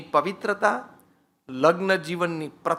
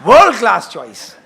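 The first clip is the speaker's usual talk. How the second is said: enthusiastic praise; 7 dB louder than usual. Shown as a demonstration. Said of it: "વધારે આનંદ લેવો હોય ને કરાવવો હોય તો".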